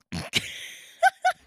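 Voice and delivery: burst of high laughter. Real, not acted. Laughs at own hospital name.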